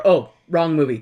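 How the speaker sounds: usual speaking voice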